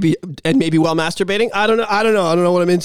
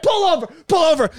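angry driver; 8 semitones higher than usual. Retold or imitated.

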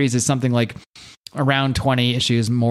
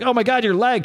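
freaked out; 11 semitones higher than usual. Retold or imitated. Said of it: "Kevin's all freaked out".

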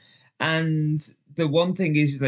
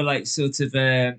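normal talking voice

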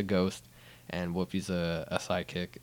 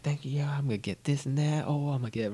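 giddy retold gloating, higher pitch; mock thrilled, pitched up; excited character; 7 semitones higher, faster than usual. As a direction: gush like someone suddenly rich. Character gloats over newfound money.

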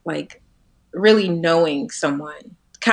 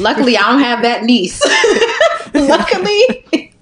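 high, loud, laughing relief; 8 semitones higher, 5 dB louder than usual. Real, not acted. Joking she lacks that niece, laughing. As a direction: crack up through the words.

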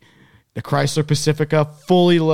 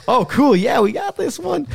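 high mock eager voice; 13 semitones higher, 4 dB louder, slower than usual. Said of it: "He's like".

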